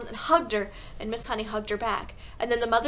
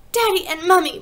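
put-on high, loud child's call; excited, high-pitched outcry; bursting out; 8 semitones higher, 11 dB louder than usual. A narrator's reading. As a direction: burst out urgently to the parents. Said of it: "Matilda bursted out, gasping for breath".

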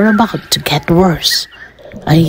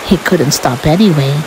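ordinary delivery

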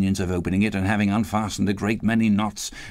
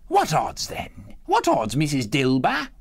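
high, brazen challenge; bold defiance; 5 semitones higher than usual. A narrator's reading. The woman defying the other two, brazen and high. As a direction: toss it out defiantly. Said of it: "looking with a bold defiance".

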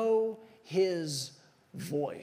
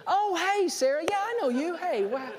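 mock surprised recognition; 9 semitones higher than usual. Acting out mock recognition of wife.

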